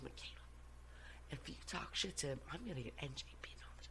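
whispered, fast, urgent warning; hushed warning. Retold or imitated. Boyfriend hushed, warning her off the officer, whispered. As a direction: hiss the warning under your breath.

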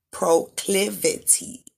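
Proclivity said slowly, each syllable clear; slow enunciation. Shown as a demonstration. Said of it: "Enunciation is important".

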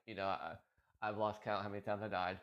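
usual speaking voice

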